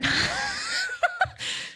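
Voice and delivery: high squealing burst of laughter. Real, not acted. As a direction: shriek with laughter.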